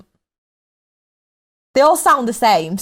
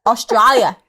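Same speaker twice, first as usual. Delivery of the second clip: country name said the Aussie way; Australian accent. Shown as a demonstration. Guest doing accents by place name, Australian accent. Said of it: "I can only do the accent of the name".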